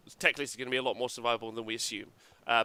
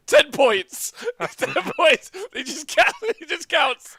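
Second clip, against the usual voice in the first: high, loud words through laughter; laughing; 8 dB louder, slower than usual. Real, not acted. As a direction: laugh while talking. Laughing at useless rule.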